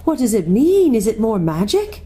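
high, urgent questions; distressed girl seeking answers; crying out. A narrator's reading. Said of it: "cried Susan".

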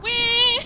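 loud, very high "whee"; high squealed whee. Acted. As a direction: squeal as if flung away. Voices the character being flung.

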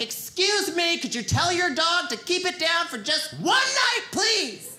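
a hint of furious neighbor banging on the door; mock angry neighbor. Downstairs neighbor complaining about the noise, mock angry yell. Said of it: "yelling at me like".

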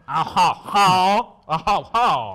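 loud, slow French "Ah, ha, ha"; nasal mock French laugh. Host mimicking nasally French, loud slow French laugh. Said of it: "like, nasally".

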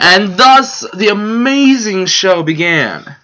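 high, drawn-out mock-epic announcement; grand proclamation. Co-host capping the show's origin story, mock-grand. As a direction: proclaim it like a legend's climax.

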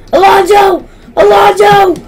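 high, loud shout to a teammate; calling out. Real, not acted. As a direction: yell the name in alarm.

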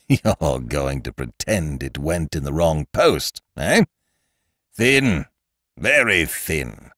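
slow, disbelieving sneer; mock the excuse slowly; a touch of malevolent scorn. A narrator's reading. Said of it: "Ukridge laughed malevolently".

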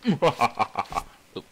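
ordinary talk

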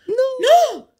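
put-on high piercing kid's no; shrill kid refusal; child's shriek. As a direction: squeal it like a defiant little boy.

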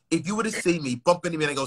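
ordinary talk, nothing marked

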